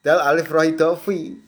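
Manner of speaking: ordinary delivery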